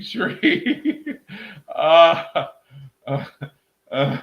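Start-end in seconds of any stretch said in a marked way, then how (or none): 0.0-1.2 laughing call. Real, not acted. Laughing, calls out name.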